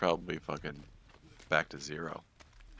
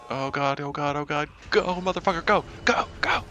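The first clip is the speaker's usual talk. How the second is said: building frantic cheer; 10 semitones higher, 8 dB louder than usual. Real, not acted. Cheering teammate's escape.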